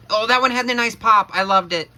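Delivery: high, loud and delighted; excited. Real, not acted. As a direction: gush with real excitement. The head pops off nicely.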